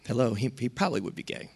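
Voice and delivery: soft, quick inner thought. Retold or imitated. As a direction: think it to yourself, half under your breath. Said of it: "just thinking".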